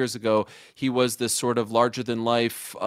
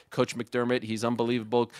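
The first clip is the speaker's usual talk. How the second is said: ordinary talk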